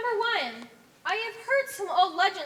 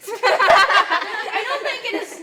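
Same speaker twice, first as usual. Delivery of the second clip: laughing; 4 semitones higher, 13 dB louder, much slower than usual. Acted. Laughing while scolding others.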